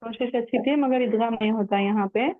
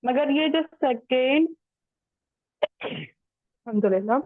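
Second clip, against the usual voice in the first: usual speaking voice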